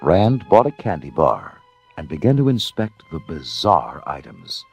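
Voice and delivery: ordinary delivery, nothing marked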